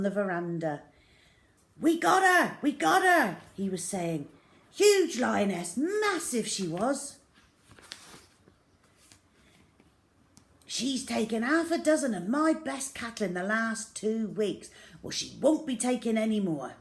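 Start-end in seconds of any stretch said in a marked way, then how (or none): 1.8-3.4 much louder, higher, exultant; triumphant shout. Acted. Father boasting of the kill. 4.7-16.8 louder and higher, bragging; boastful father. Acted.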